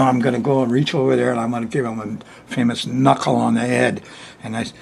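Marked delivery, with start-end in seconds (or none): none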